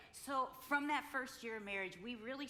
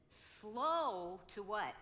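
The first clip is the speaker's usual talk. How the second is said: very slow teacher prompt; drawn-out prompt; much slower than usual. Shown as a demonstration. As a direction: stretch it to cue the crowd. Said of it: "teacher prompting audience".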